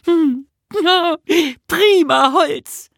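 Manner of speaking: very high, loud delighted cry; overjoyed. A narrator's reading. Nisse hops for joy at the wood, overjoyed calling. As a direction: cry out slowly with hopping delight. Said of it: "Nisse hüpfte vor Freude".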